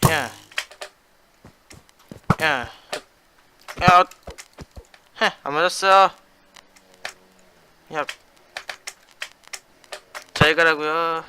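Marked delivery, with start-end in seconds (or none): none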